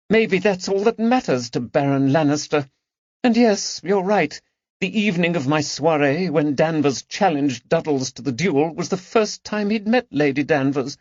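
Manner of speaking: high, wounded aristocratic voice; grieving the loss of a lover to treachery; sad lady's lament. Acted. Lady Caroline lamenting to her friends, high and sad.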